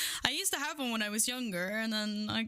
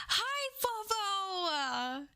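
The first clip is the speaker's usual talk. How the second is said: very high delighted greeting; high-pitched excited greeting; squealing hello; 8 semitones higher, much slower than usual. Acted. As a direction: squeal the name with joy.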